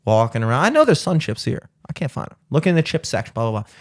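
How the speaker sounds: normal talking voice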